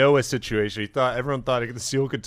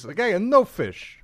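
put-on Boston accent; 6 semitones higher, slower than usual. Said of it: "impression of Hoover".

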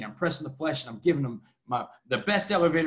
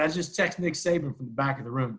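ordinary talk, nothing marked

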